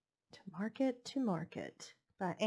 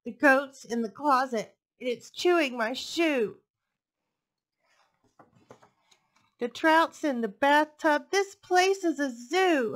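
higher, louder, exasperated list of chaos; frazzled shopper; 7 semitones higher, 8 dB louder than usual. Acted. The shopper complains about animals in her house, loud and fed up. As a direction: pile up the complaints, getting more fed up.

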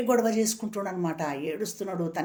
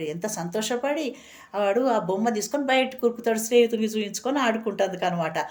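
normal talking voice

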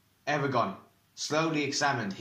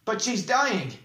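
high, loud, heated retort; angry retort; 9 semitones higher, 4 dB louder, slower than usual. A narrator's reading. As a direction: fire it back in anger. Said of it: "retorted Eragon angrily".

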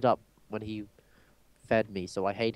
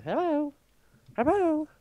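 silly high hello. Pretend. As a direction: sing out a goofy hello way up high. Silly high-pitched greeting in game.

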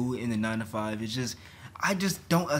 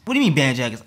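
loud, indignant question; loud disbelief; 9 dB louder, faster than usual. Real, not acted. Outraged at the jacket ban.

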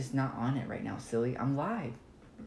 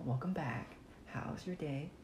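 ordinary talk, nothing marked

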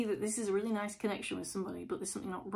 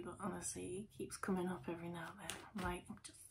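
much softer, faster, hushed aside; dropping to hushed; 2 semitones lower, 13 dB quieter, faster than usual. Real, not acted. Reader confiding an aside to viewers, hushed. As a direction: slip into a hushed confession. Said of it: "I don't know why I'm whispering".

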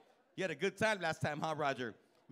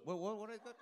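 high, soft confused "what, what" as a coworker; two construction workers turning at once, puzzled; 5 semitones higher, 6 dB quieter than usual. Retold or imitated. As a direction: play a confused guy turning around. Both men turning at the name.

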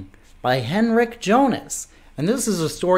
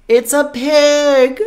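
little bird; 8 semitones higher, 4 dB louder, slower than usual. A narrator's reading. Little bird guessing in the story.

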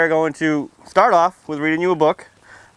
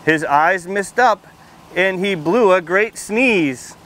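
put-on lively storybook read-aloud for little kids; reading to children; 2 semitones higher, slower than usual. A narrator's reading. Firefighter reading a picture book to kids at story time, lively read-aloud. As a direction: read it out bright and clear for the children.

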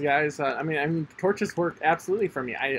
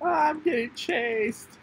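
much higher, slowed mock panic; high-pitched whine; 12 semitones higher, slower than usual. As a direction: squeak it like a cartoon victim. High squeal while chased.

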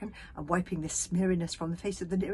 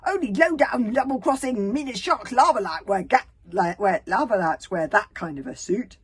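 loud, heated tirade; forceful, accusing shout; angry accusation; 4 semitones higher, 12 dB louder than usual. A narrator's reading. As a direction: hurl insults at an enemy. Angry chief accuses a stranger.

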